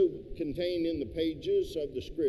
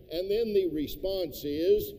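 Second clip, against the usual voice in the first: ordinary talk, nothing marked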